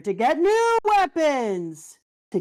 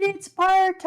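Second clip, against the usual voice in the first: excited shout; 10 semitones higher, 5 dB louder than usual. Real, not acted. Excited fan reaction.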